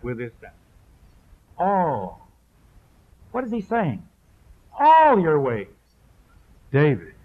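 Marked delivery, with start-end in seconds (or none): 1.6-2.3 All said alone, stretched and weighty; stress on All. Shown as a demonstration. Preacher stressing the first word to the congregation. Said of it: "emphasize each one". 4.8-5.7 "All" hit high and loud; stress on All. Shown as a demonstration. Preacher driving home each word of the verse. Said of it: "emphasize each one".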